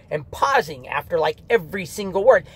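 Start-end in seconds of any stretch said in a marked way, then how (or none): none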